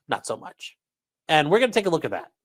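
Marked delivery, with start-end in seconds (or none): 0.1-0.8 softer mock dismissal. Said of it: "and they said".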